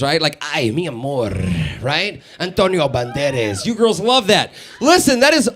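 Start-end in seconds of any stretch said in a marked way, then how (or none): 0.4-1.3 put-on low, suave Spanish accent; mock Spanish seducer, voice dropped low; Antonio Banderas. 2.4-3.6 put-on low, rolled Spanish accent; mock-sultry Spanish pronunciation, pitched low.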